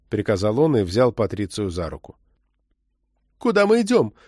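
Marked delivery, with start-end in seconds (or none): 3.4-4.1 high-pitched alarmed question; frightened. A narrator's reading. Woman being led away by an agent, frightened. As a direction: ask it in sudden fright. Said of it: "испугалась она".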